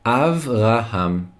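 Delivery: "Avraham" drawn out syllable by syllable; slow model pronunciation. Shown as a demonstration. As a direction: say "Avraham" very slowly for learners. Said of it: "Say it with me".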